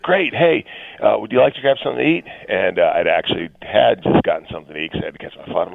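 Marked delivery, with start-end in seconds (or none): 0.0-2.2 higher-pitched, eager acted hello; upbeat greeting. Retelling meeting a friend at Denver airport, upbeat and high. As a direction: act out two pals happily catching up.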